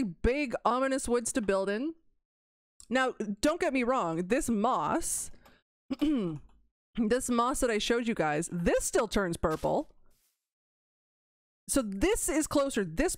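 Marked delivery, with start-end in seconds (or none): none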